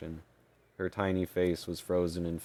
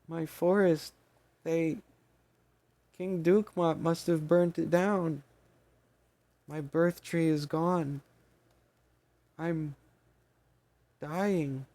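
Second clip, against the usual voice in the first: weak squeaky fairy; 10 semitones higher, slower than usual. Acted. Dying fairy laments, squeaky voice.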